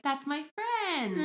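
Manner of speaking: put-on high, slow, sweet little-boy voice. Retelling a little boy meeting his sparrow, childlike. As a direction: draw it out like a delighted small child.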